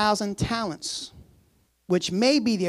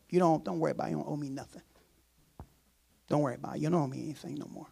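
lower, much softer reassurance; gentle master; 5 semitones lower, 9 dB quieter, much faster than usual. Pretend. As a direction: soothe him, let the debt go. Master voiced forgiving the debt.

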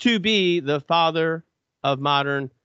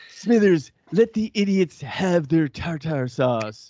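Mister Burns; 2 semitones higher, slower than usual. Retold or imitated. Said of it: "And Mister Burns said to Smithers, he's like".

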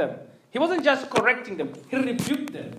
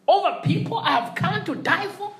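normal talking voice

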